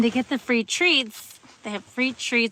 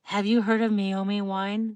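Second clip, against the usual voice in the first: normal talking voice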